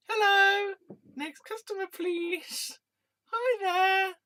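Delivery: high, perky customer-service voice; cashier voice. Acted. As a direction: chirp like a bored shop clerk. Shop clerk skit.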